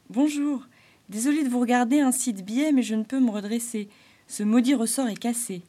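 a bright stuck jester apologising; Arlequin's voice. Acted.

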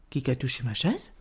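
low, soft middle-bear voice; middle bear's question. A narrator's reading. Médio Ours echoes the big bear, subdued question. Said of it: "s'escria à son tour Médio Ours".

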